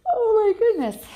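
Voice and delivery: loud, high startled exclamation; real surprise. Real, not acted. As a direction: blurt out the reader's own shock. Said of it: "reader's own reaction".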